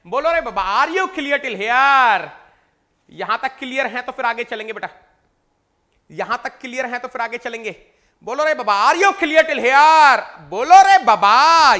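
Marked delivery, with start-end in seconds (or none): 0.1-2.3 loud, high call to the class. Real, not acted. Teacher calling loudly to an online class. 8.3-11.7 loud, high call to the class. Real, not acted. Teacher calling loudly to an online class.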